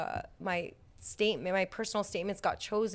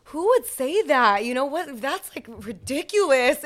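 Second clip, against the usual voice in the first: higher, louder, slow, hammed-up outrage; exaggerated fake denial to parents; mock indignant denial; 6 semitones higher, 10 dB louder, slower than usual. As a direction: play the innocent, overly offended. Denying motorcycle to parents.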